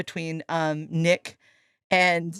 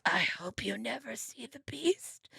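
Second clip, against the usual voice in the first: slow ominous whisper; spooky whisper; 12 dB quieter than usual. Retold or imitated. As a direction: hush it like a horror warning. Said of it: "And he goes".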